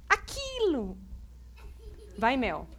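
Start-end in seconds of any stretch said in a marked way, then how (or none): 0.1-1.0 high puppet squeak. Acted. Puppet Mel repeats the pastor's "aquilo" in a high voice. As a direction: blurt it out as the puppet.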